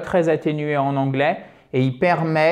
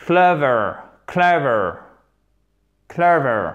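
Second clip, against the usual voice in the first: Clever hit on CLE, falling after; first-syllable stress; slower than usual. Shown as a demonstration. Teacher demonstrates for viewers, CLE-ver stressed first. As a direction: model clever with strong first syllable, spaced repeats. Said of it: "Accent tonique, il est où?".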